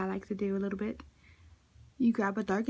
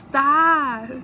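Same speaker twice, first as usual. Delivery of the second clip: loud laughing protest; pleading through laughter; 4 semitones higher, 11 dB louder than usual. Real, not acted. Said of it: "I can't stop laughing".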